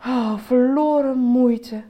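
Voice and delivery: slow, loud sighing lament; deep despairing sigh. A narrator's reading. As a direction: sigh it out hopelessly. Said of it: "zuchtte hij diep en wanhopig".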